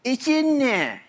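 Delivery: high, emphatic it's in there, echoing the ad; mama's catchphrase. Retold or imitated. Speaker to designers, quoting the ad mama in a high voice. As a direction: deliver the tagline like the Prego mama. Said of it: "whatever tools you need".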